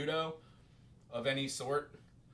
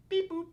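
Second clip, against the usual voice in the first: beep boop; 13 semitones higher, 3 dB louder than usual. Shown as a demonstration. Mouthing the rhythm.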